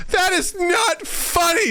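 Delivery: high, slow, whiny outrage; mock outraged wife. Husband dutch-ovens her, wife protests in high mock outrage. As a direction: whine it like an offended wife. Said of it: "She goes".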